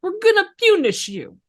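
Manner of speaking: put-on high, punchy New York accent; tough-guy New York accent. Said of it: "it almost sounds like a New York accent there".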